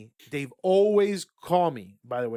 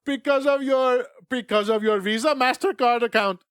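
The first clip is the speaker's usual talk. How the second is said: mock Indian call-centre accent, raised and louder; 6 semitones higher, 6 dB louder than usual. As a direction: imitate the Indian scammer's accent, polite "Sir". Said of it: "I don't wanna do the accent".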